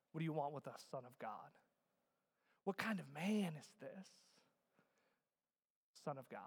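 low, soft acted demons' question; hushed proclamation. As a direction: say it softly and low. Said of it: "the demons proclaim".

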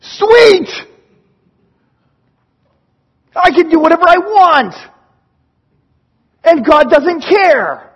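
loud, high-pitched mock glee; gleeful. Forgiven person celebrating a license to sin, gleeful. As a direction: crow it like you got away with everything. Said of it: "they go".

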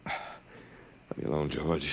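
slow, barely voiced plea; begging through the pain, almost no voice; exhausted plea. Tortured Walter begs his captor George, faint and slow.

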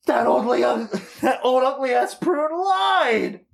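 high, strained mock-furious cry; hammed-up outrage. Fan rages at a lying villain, hammed-up outrage. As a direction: draw out the last word in mock outrage.

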